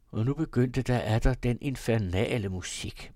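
usual speaking voice

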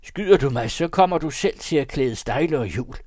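high, loud warning from the poacher; threatened poacher. Acted. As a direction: warn him off loudly. (højlydt, advarende krybskytte)

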